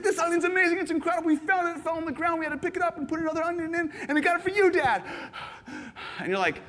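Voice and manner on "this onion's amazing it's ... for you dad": put-on high, rushed run-on in a child's excitement; imitating an overexcited little son; breathless excited kid. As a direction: spill it all out without stopping. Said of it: "He talks even quicker in those moments".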